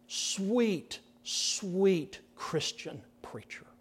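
slow, soft whispered fondness; whispered affection for a mentor; tender whisper. Real, not acted. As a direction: linger on it lovingly. Fond tribute to Sproul.

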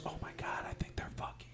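unvoiced hushed whisper. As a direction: whisper it like overhearing through a door. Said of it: "she goes".

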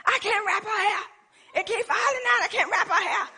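high-pitched mock tantrum; imitating mad husband. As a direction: whine it high like an exasperated husband. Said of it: "He got so mad".

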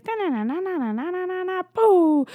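high, loud singsong nonsense ending in a big boo; playful sculpting noises. Retold or imitated. Podcaster mimes God moulding clay, playful high loud sound effects. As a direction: babble a high da-na-na, then burst out loud. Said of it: "He was like".

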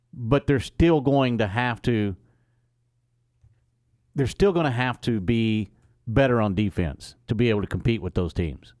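emphatic. Real, not acted. Emphatic sports debate, loud.

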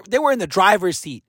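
usual speaking voice

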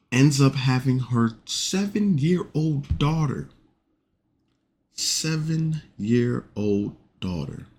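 slow, high, stunned repeat; disbelief. Real, not acted. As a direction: let the shock stretch each word. Incredulous retelling, repeats phrase.

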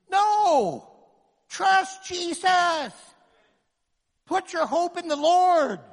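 loud, high, slow cry of faith; fiery shout. Real, not acted. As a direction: cry it out to the crowd. Preacher shouting reply.

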